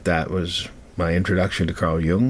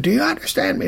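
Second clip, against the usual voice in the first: much higher, mock yelled question; retold angry demand; faster than usual. The man pressing the boy a second time, mock angry demand. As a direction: shout the demand again, imitated.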